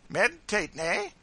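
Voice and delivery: high, loud playful jab; loud mock-jovial needling; facetious teasing. A narrator's reading. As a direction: tease them in a high, loud voice. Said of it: "poking him facetiously in the side".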